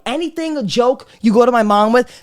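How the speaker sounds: ordinary talk, nothing marked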